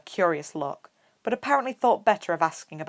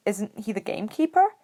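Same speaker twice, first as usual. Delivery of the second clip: confused; 4 semitones higher than usual. A narrator's reading. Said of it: "Harry asked, confused".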